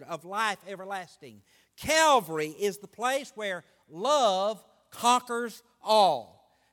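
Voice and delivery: emphatic refrain, hitting each opening word hard; slow, measured pulpit proclamation with ringing repetition; preaching. Real, not acted. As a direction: drive each phrase home, pausing before the payoff.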